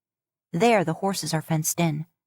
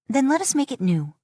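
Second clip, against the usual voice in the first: raised, insistent Mila; arguing; 8 semitones higher than usual. A narrator's reading. Mila arguing with her uncle, pitched up. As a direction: push back at the chief. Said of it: "she argues".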